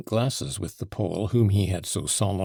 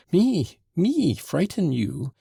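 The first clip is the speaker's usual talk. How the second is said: high, louder protesting cry; astonished cry; 9 semitones higher, 4 dB louder, slower than usual. A narrator's reading. Mitya protesting he'd never frighten her, crying out. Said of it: "cried Mitya".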